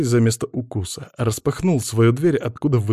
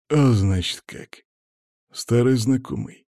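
sneer. A narrator's reading. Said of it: "процедил он".

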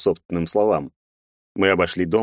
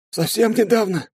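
much higher, timid soldier's voice; a scared young sergeant answering; frightened soldier. A narrator's reading. Soldier's high voice. (испуганный солдат, высоким голосом)

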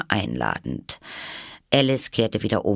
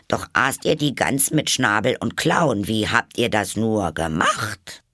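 high, animated, louder-edged verse; poem recitation; 4 semitones higher, 3 dB louder than usual. A narrator's reading. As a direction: recite the rhyme with lively lilt. (lebhaft aufgesagtes Gedicht)

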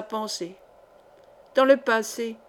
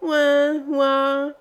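louder, high piping imitation of a baby crying; mock baby squeal; 4 semitones higher, 5 dB louder than usual. Tipsy maid mimicking the baby once more, louder mock squeal. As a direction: squawk it again like the infant, overdone. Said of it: "piailla encore une fois".

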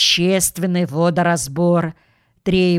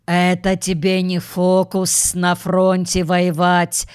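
plain reading voice

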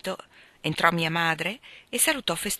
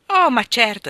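higher and much louder, eager hostess; eager exclamation; 5 semitones higher, 10 dB louder than usual. Acted. Mother happily offers the vicar beer, eager exclamation. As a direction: jump in delighted to serve. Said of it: "esclamò mia madre".

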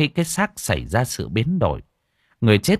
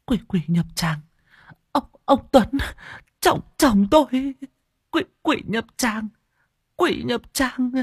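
much higher, gasping stammer; breathless babbling. Acted. Old woman babbles in terror while leading monk home. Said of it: "nói ú ớ chẳng ra hơi".

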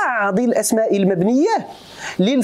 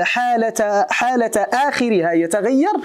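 ordinary talk

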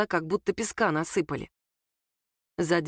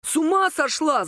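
high-pitched outburst, moderately raised volume; angry yell; 8 semitones higher, 4 dB louder than usual. A narrator's reading. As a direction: cry out in outrage, narrator's restrained yell. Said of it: "заорал он".

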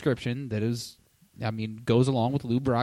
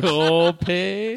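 higher, louder Minnesota "Copes"; mock Minnesota accent; 7 semitones higher, 7 dB louder than usual. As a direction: parody the Minnesota way of saying "Copes". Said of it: "Now everybody's doing it".

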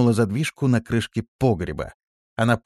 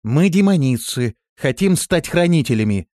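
loud, raised, assured answer; confident; 7 semitones higher, 6 dB louder than usual. A narrator's reading. Листи introducing the girls to the hidden voice, confident. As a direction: announce it with certainty. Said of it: "уверенно ответила Листи".

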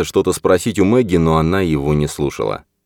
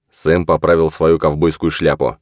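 plain reading voice

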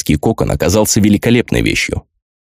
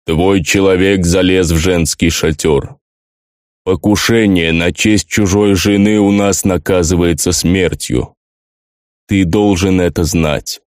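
slow, heavy orc chieftain voice; stern orc chief; slower than usual. Acted. Orc chief accuses at execution.

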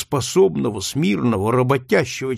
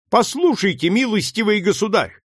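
put-on high, lofty scorn from a boy; young George addresses the slave trader haughtily, high-pitched; haughty boy; 7 semitones higher than usual. A narrator's reading. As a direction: look down on the trader as a young gentleman. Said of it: "с надменным видом обратился к нему Джордж".

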